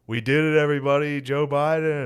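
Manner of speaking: high, loud rally cheer; hype announcer. Retold or imitated. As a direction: pump up the crowd. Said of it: "he started it by being like".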